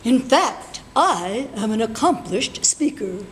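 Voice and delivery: ordinary talk, nothing marked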